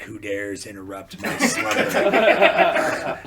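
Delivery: loud, drawn-out grandiose demand; mock outraged chef. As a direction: boom it out slowly like a disturbed tyrant. Retold angry chef, vein bulging.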